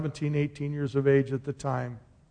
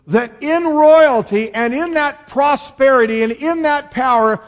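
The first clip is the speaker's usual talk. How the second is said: higher, louder preaching voice, every phrase pushed out; full-force preaching; 7 semitones higher, 8 dB louder than usual. Acted. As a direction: proclaim it to the congregation like a revival preacher. Preaching about kingdom and royalty.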